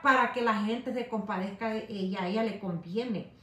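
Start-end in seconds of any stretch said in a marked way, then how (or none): none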